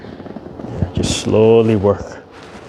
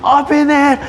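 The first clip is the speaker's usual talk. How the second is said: put-on high, louder little-kid demand; toddler demanding; 5 dB louder than usual. Grandpa imitating toddler's impatient demand, high-pitched. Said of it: "she's like".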